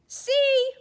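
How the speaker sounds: high excited squeal. Acted. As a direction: burst out high and loud. Character exclaims in story.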